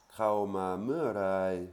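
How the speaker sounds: "Kraoma me rai" drawn out slowly and loudly; slow, loud demo; slow Thai demo. Shown as a demonstration. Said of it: "Là, on a un pronom".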